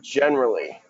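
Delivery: "generally" said as a key word on its own; emphasis on generally. Real, not acted. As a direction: land deliberately on "generally". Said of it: "I really wanna emphasize that word".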